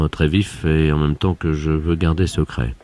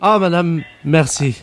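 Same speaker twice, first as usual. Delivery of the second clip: gushing thanks; 13 semitones higher, slower than usual. Acted. Boss thanks pastry shop.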